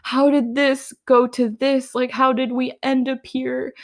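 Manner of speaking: half-hearted imitation of someone about to cry; mock crying. Remembering a breakup, imitating her own crying self. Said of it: "you start to cry and you're like".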